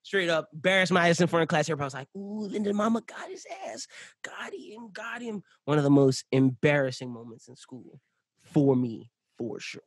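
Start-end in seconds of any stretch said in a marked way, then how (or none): 2.2-5.4 whispered, teasing ooh; whispered schoolyard taunt; mock classmates teasing. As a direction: hiss it like kids taunting a classmate.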